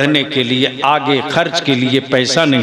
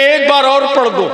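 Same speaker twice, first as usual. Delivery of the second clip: eager plea; 11 semitones higher than usual. Retold or imitated. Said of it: "Farooq आजम आगे बढ़ के कहते".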